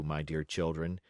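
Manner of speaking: plain reading voice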